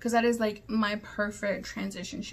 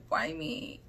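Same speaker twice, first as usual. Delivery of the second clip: mock plaintive whine; 6 semitones higher than usual. Real, not acted. Ranting at a hater.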